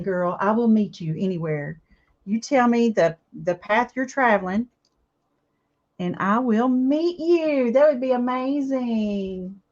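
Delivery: ordinary delivery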